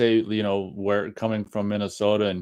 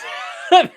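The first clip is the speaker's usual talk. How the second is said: laughing at own accent; 7 dB louder than usual. Real, not acted. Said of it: "crying tears of laughter".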